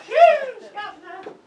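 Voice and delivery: a sudden loud shoe-shine cry; urchin call. Acted. Urchin answering the fiance with a loud call. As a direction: bark it like a street kid.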